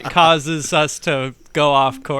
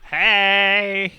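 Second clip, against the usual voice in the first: long, raised hey; calling out; 4 semitones higher than usual. Acted. Player calls for anyone in the castle, drawn-out hail. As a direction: call into an empty castle.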